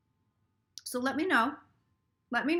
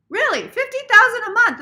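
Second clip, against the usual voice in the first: much higher and louder, amazed; banker's astonishment; 11 semitones higher, 12 dB louder than usual. Retold or imitated. Imitating the banker hearing the figure, astonished. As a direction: gasp at the number.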